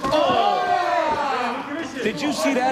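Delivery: high, loud amazed outburst; excited. Real, not acted. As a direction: gasp in disbelief. Commentator reacts to right hand.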